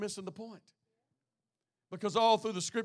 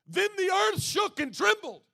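high, loud shout of the verse; booming proclamation; 14 semitones higher, 10 dB louder than usual. Acted. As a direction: cry out The earth shook like thunder.

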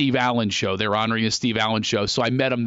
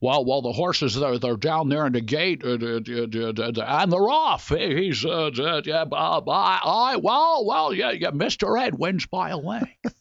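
Jimmy Stewart race caller; 5 semitones higher than usual. Retold or imitated. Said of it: "do Jimmy Stewart as a horse race announcer".

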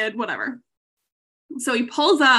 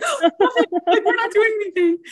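startled denial; 8 semitones higher, 3 dB louder, faster than usual. Retold or imitated. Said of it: "She's like, gasps".

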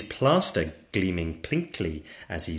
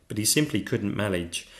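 usual speaking voice

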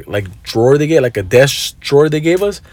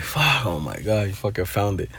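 relieved sigh; 4 semitones lower, 11 dB quieter than usual. Retold or imitated. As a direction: let the tension drain out. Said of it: "I'm like".

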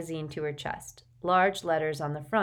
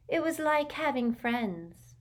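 serene; 8 semitones higher, slower than usual. A narrator's reading. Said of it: "said Luna serenely".

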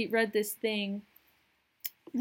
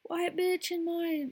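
mock neighbor freaking out; 8 semitones higher than usual. Neighbor calling the cops on a stranger, high mock panic. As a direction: shriek it like a scandalized homeowner. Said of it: "They're like".

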